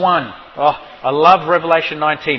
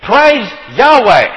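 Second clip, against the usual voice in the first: proclaimed praise; 5 semitones higher, 8 dB louder, slower than usual. Shown as a demonstration. Preacher crying out Praise Yahweh to the congregation, loud. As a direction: Praise Yahweh called out loud and high, drawn out slowly. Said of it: "to shout hallelujah".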